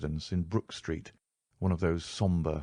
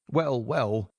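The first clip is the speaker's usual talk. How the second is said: higher, hesitant nervous voice; 5 semitones higher than usual. A narrator's reading. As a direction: stall uneasily for time. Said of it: "in a nervous fashion".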